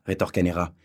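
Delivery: usual speaking voice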